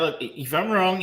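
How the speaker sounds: normal talking voice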